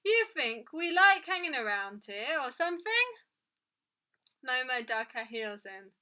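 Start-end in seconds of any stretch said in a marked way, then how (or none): 0.0-3.1 loud, high, affronted complaint; indignant, raised customer's voice. A narrator's reading. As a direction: call out crossly from the queue. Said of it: "called another indignant voice".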